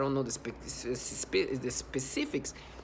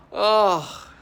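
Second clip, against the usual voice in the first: loud, raised groan of dismay; exasperated groan; 4 semitones higher, 8 dB louder than usual. Real, not acted. Exclaims in disgust at the humiliation.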